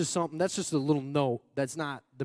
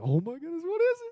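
higher, rising to a squeal on what is it; excited. Retold or imitated. Preacher acting out joy at a present, excited. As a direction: gasp it like unwrapping a present. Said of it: "you're just like".

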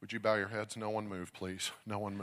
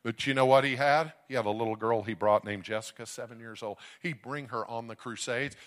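normal talking voice

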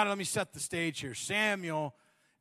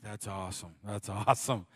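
distracted husband; 8 semitones lower, 4 dB quieter than usual. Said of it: "You're like".

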